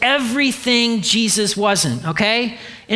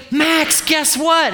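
eager, raised shout of a friend bursting with news; excited call; 8 semitones higher, slower than usual. Retold or imitated. Retelling an excited convert's announcement.

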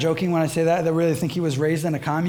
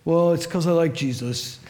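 lightly voiced hippie surfer; a hint of California dude; surfer-dude confession. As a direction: own up like a mellow surfer. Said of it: "he really does talk like that".

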